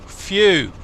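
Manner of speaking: a loud, high puff of relief; relieved phew. Real, not acted. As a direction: blow out the tension with one big phew. Relief after engine start scare.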